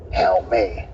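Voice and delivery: low, drawn-out pleading moan; a hint of a trapped voice calling through a door; eerie plea for help. Hidden man in bus bathroom begging to be let out, low eerie moan. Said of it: "like that, like this sound".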